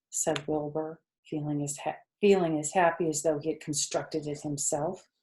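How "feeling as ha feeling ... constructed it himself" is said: ordinary delivery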